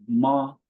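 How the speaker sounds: "ma" held on a high, level tone; "ma" as a steady Mandarin first tone. Shown as a demonstration. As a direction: keep "ma" up high and even. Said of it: "The first one is".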